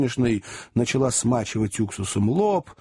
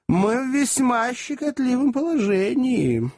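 fussy little lawyer; 9 semitones higher than usual. A narrator's reading. Little lawyer counsels compromise. (деликатный поверенный, высоко и неторопливо)

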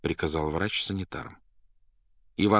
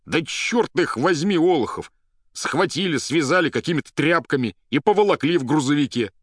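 loud, high-pitched outraged complaint; indignant angry poet; 10 semitones higher, 7 dB louder than usual. Acted. Patient complains to doctor of being tied up, indignant. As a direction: rail against your captors, loudly. Ivan rants at the doctor about his captors.

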